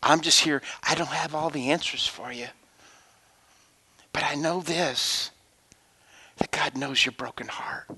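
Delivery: quiet, hushed reassurance; soft words to a grieving woman; soft comfort. Retold or imitated. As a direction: gently console her.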